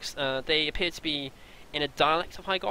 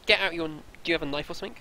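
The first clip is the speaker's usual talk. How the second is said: ordinary delivery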